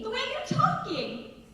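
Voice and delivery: very high imitated child's voice; puzzled son. Son challenges mom's new manner, high-pitched imitated child. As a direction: play a kid baffled by mom. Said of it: "He's like".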